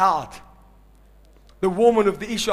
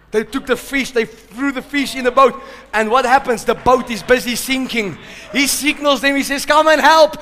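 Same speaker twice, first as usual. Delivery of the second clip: higher and louder, shouting the blessing; preaching at full force; 7 semitones higher, 7 dB louder than usual. Acted. Preacher exhorting congregation, shouting at full force. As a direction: build to a shouted climax.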